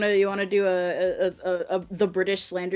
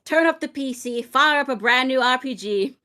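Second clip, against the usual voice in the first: higher, projected British ranter's voice; British accent impression; 5 semitones higher than usual. Friend performing the pronouns rant on request, British accent. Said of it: "your best British accent".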